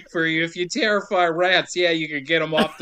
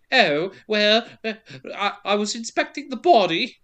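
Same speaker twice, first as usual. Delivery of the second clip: higher, hesitant not-quite-British period voice; mock prissy Johnny Depp; 4 semitones higher than usual. Podcaster mocking the actor's stupid voice, prickly and posh. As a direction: do a silly revolutionary-era posh accent. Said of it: "so it's sorta like".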